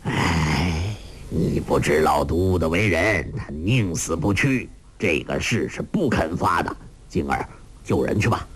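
put-on old master resigned; sighing old man. A narrator's reading. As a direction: sigh and give in. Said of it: "洪七公叹道".

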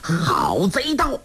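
high, drawn-out roar of accusation; furious, stretched-out challenge; angry shout. A narrator's reading. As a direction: bellow it slowly at the villain. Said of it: "杨铁心喝道".

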